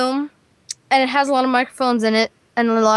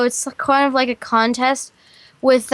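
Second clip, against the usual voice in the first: usual speaking voice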